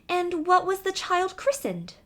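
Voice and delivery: high curious mouse voice; a small mouse asking eagerly. Acted. Mouse asking about the christening, high curious voice.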